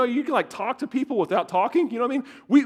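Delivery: normal talking voice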